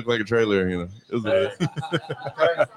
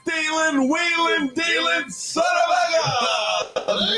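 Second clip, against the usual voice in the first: high shouted wrestler catchphrase; Ric Flair impression; much slower than usual.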